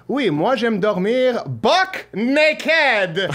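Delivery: high, very slow exaggerated French accent; mock French accent. Host joking about a Parisian in a mock French accent. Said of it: "anyone in Paris right now is saying".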